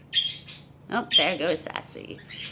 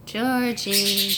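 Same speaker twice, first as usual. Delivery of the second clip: ordinary talk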